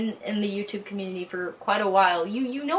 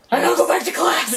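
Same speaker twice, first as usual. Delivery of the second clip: sudden rushed alarm, higher and louder; panicked realization; 4 semitones higher, 5 dB louder, faster than usual. Retold or imitated. Girl realizing she missed class, rushed alarm. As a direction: blurt it out in a panic, fast. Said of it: "I was like".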